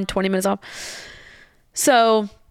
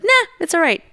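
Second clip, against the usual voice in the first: easygoing brush-off; 9 semitones higher, 3 dB louder than usual. Retold or imitated. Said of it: "he's, like, that type that's like".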